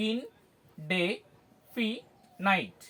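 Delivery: ইন, ডে, ফি, নাইট with a pause between each; syllable by syllable. Shown as a demonstration. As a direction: teach the spelling by isolating ইন... ডে... ফি... নাইট. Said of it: "ভাগ করে করে দেখি". (ইন-ডে-ফি-নাইট, প্রতিটি syllable আলাদা করে)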